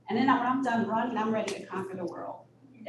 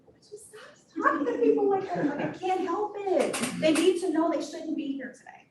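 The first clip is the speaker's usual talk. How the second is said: higher-pitched scolding mother, retold; exasperated mom; 7 semitones higher than usual. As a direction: plead in mock exasperation like an embarrassed mom.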